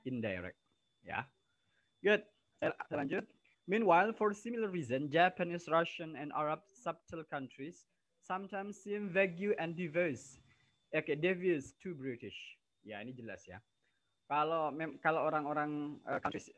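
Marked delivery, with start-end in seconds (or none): 3.7-12.6 loud, careful textbook reading; reading aloud. Acted. Teacher reads the English text to her students, loud and careful. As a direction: read the passage out to the whole class.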